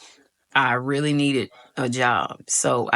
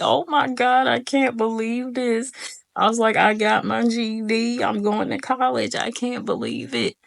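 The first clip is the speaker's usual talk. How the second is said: higher, tearful disbelief; crying with joy; 9 semitones higher than usual. Retold or imitated. Crying outside the college after passing, higher with joy. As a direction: gush through happy tears. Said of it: "crying my eyes out".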